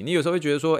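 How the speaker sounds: normal talking voice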